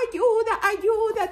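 high, loud repeated cry; sheep shouting. Acted. Little sheep shouting for help, high and loud. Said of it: "también gritaban las ovejitas".

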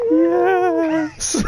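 ghoulish voice. Pretend. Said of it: "That was my ghoulish voice, my ghostly yes".

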